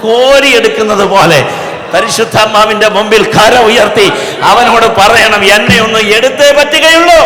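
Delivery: high, very loud preacher's shout; impassioned revival shout, rising to the end; full-force preaching. Real, not acted. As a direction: build the appeal to a roaring climax. Preaching builds to climax.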